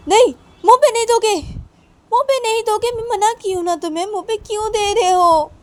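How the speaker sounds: high, loud, fast scolding; scolding child. Acted. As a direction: tell the child off sharply. Mother scolds child for mouthing toy. (बच्चे को ऊँची तेज़ आवाज़ में डाँटती माँ)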